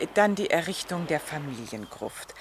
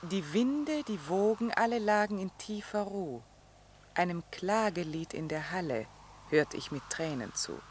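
softer, solemn recital of the ballad's ending; reciting a poem; 6 dB quieter than usual. Acted. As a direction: read the lament with quiet gravity. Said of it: "Später heißt es dann".

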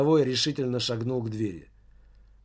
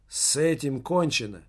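ordinary delivery

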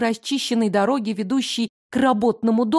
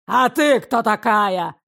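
hostile challenge; 3 dB louder, slower than usual. Acted. Bearded father turns on her.

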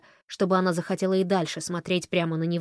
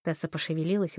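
usual speaking voice

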